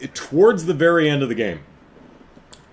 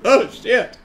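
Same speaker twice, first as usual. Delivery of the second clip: mock panic; 10 semitones higher, 3 dB louder than usual. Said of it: "It's like".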